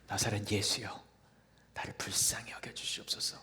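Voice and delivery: soft whispered plea, hushed; whispered cry. Acted. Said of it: "소리를 질렀지요". (속삭이듯 애원하는 외침)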